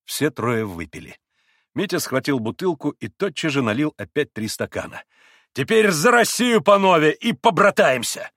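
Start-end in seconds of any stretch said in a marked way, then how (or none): 5.5-8.3 loud, high, exuberant proposal; rally them to drink as brothers; rousing toast. A narrator's reading. Mitya proposes a toast to Russia, loud and rousing.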